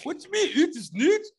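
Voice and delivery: high, protesting Baltimore parody; mock Baltimore contestant. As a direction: play a baffled Baltimore spelling contestant. Mock Baltimore spelling bee contestant.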